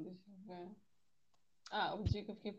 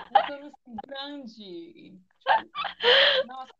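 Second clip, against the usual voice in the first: loud, high outburst at the pipe; shouting about how big the pipe was; retold outrage; 6 semitones higher, 18 dB louder, much slower than usual. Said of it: "derrubou o cano na minha cabeça". (gritando indignada com o tamanho do cano)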